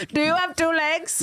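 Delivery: put-on Indian accent; hammed-up Indian cold caller. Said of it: "worst fucking Indian accent".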